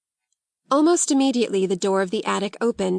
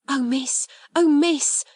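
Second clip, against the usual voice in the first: higher exclamation, rising on the repeat; overwhelmed gasp; 6 semitones higher, 3 dB louder, slower than usual. Acted. Becky thanks Sarah for the invitation, gasping. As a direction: overcome by sudden good luck. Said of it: "she gasped".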